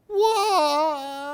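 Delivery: loud high wail, hammed-up baby cry; wailing tree. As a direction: wail like a crying toddler. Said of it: "wailed the tree".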